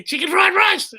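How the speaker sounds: very high, strained mock yell; imitated heckler scream. Drunk heckler still yelling forty minutes in, high mock cry. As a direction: wail it like a drunk who won't quit. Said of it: "I hear".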